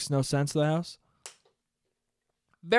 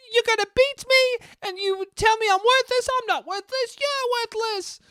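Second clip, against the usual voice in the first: James dark moment. Retold or imitated. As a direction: wail it like a hammy child actor. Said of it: "he goes".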